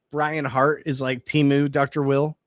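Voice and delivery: ordinary talk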